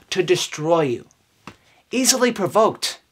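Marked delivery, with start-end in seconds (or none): none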